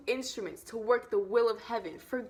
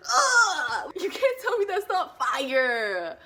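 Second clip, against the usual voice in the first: high, loud whoop of hype; real giddy outburst; over-excited; 6 semitones higher, 8 dB louder, slower than usual. Real, not acted. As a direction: burst out with loud, drawn-out excitement. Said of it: "I'm getting way too excited".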